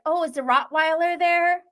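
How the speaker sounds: mock Stephanie. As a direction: needle her with a sweet, teasing lilt. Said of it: "Stephanie's like".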